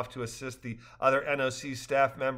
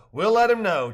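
higher, louder lively dialogue; cheerful characters; 8 semitones higher, 9 dB louder than usual. A narrator's reading. Rafters joking after the trip, higher and louder. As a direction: voice the rafters brightly.

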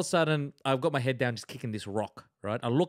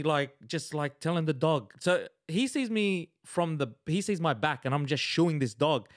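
ordinary delivery

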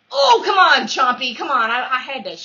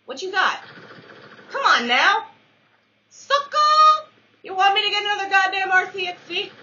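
loud taunting; 11 semitones higher, 9 dB louder than usual. Real, not acted. Taunting the enemy loudly.

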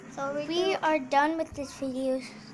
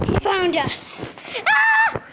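loud excited scream; shouting; 3 semitones higher, 13 dB louder, slower than usual. Real, not acted. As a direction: yell as you're caught. Caught while hiding.

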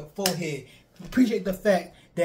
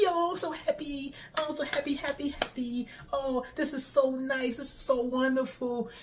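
higher, softer sugary mock-happy fan voice; mocking a fan who only wants feel-good shows; 5 semitones higher, 3 dB quieter than usual. Vlogger mocking fans who want only happy shows, sugary voice. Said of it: "Do you watch for just the".

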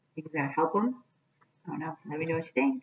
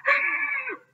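sharp high startled yelp, louder; startled cry; 8 dB louder than usual. Acted. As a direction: jump at the touch with a scared cry. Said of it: "he cried".

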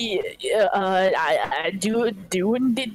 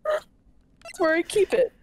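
high mocking echo; 12 semitones higher, slower than usual. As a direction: echo her in a silly high voice. Laughing mock repeat.

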